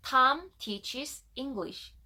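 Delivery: slow, spaced-out English words; model for repeating. Shown as a demonstration. Teacher giving learners the last sentence to repeat, slow model reading. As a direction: say it slowly and distinctly for learners to echo. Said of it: "세 번씩 따라합시다".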